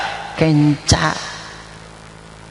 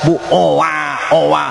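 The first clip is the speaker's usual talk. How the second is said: slow mock baby wail; imitating baby crying; slower than usual. Mother soothing crying baby in bus.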